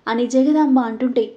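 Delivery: plain reading voice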